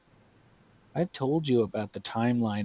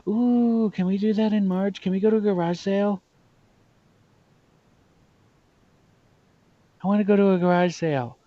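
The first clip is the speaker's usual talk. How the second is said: high, fast, eager begging; excited pleading; 7 semitones higher, 4 dB louder, faster than usual. Real, not acted. Friend begs to go to a garage sale, excited. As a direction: bounce with excitement about the sale.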